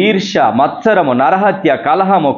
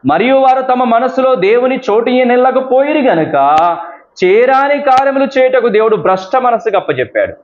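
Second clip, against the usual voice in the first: raised, measured Bible reading voice; scripture reading; 6 semitones higher than usual. Acted. Pastor reading Romans to the church, raised and measured. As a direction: read the verse out to the congregation.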